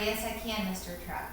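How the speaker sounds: usual speaking voice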